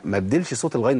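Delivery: ordinary talk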